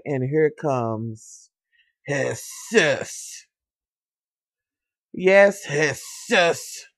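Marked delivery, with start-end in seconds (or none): 2.0-3.5 "Jesus" said the Spanish way, "heh-SOOS", drawn out; playful name pronunciation. Pretend. Announcing who comes along, playfully drawn-out "Jesus". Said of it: "y'all know I gotta say it like that". 5.6-6.9 "Jesus" as "heh-SOOS", both syllables drawn out; playful name pronunciation. Pretend. Repeating the name for y'all, playfully drawn-out "Jesus". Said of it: "y'all know I gotta say it like that".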